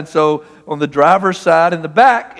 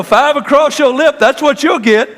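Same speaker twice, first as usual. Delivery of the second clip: higher mock threat, hammed up; Fred Sanford threat; 8 semitones higher, 3 dB louder than usual. Preacher mocking his own threat at the driver, hammed-up Fred Sanford. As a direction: play the grumpy sitcom dad threatening. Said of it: "I'm doing my Fred Sanford".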